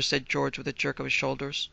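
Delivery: usual speaking voice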